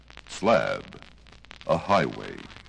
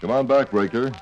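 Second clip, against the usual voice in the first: over cb radio; 5 semitones higher, 4 dB louder than usual. Acted. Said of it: "let's review the preceding dialogue".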